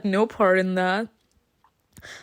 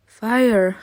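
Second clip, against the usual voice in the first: usual speaking voice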